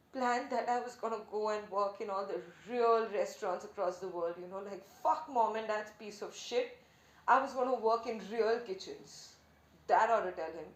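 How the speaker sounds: loud, fired-up rant; acted angry, forceful vow; heated defiance. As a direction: let the old grudge push the volume up. Angrily recounting her revenge plan.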